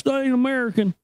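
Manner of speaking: high-pitched mock indignant customer; put-on whiny complaint; obnoxious customer. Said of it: "he was just a total douche".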